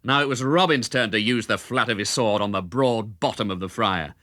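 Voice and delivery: ordinary delivery, nothing marked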